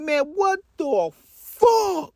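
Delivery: drawn-out high furious yell; slow high angry shout; outraged yell. Retold or imitated. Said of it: "He's like".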